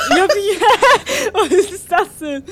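high-pitched giggling through words; laughing. Real, not acted. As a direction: laugh while trying to talk. Bursts out laughing mid-sentence.

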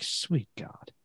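whispered under the breath in disbelief; exasperated whisper. Retold or imitated. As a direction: breathe it out, fed up. Exasperated aside at drive-thru.

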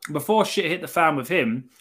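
usual speaking voice

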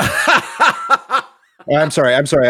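apologizing through laughter; can't stop laughing mid-apology. Real, not acted. Laughs through apology after meme.

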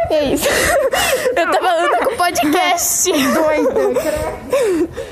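giggling through the words; laughing. Real, not acted. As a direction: laugh while you say it. Laughing at talking to podcast.

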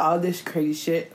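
ordinary delivery

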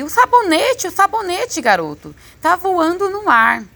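high-pitched, flustered exclamations; agitated father. A narrator's reading. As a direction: cry out in amazement at a floating object. Father panics at flying soap. (exclamação aguda e agitada)

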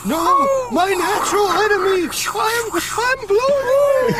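high, loud wailing cry; hammy panic. Pretend. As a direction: melodramatic scream, stretching out as it blows away. Acting blown away by wind.

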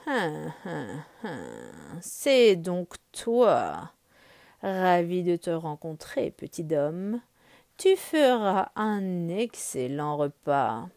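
low, slow purring tiger voice; menacing tiger. Acted. Shere Khan greeting his prey, low and slow. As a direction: savour each word like a predator.

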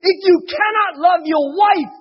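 fervent, loud sermon delivery; preaching. Acted. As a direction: preach it out with full force. Preaching the moral.

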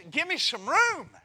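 high, loud mock wife brushing him off; hammed-up wife pushing him away; annoyed wife. Preacher playing his wife telling him off, high and loud.

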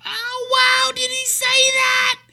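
very high, slow, loud gasp of wonder; mocking amazed stranger. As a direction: gush in fake amazement. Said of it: "they're all".

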